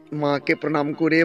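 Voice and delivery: ordinary delivery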